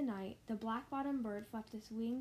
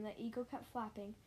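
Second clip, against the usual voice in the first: ordinary delivery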